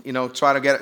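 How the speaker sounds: ordinary talk, nothing marked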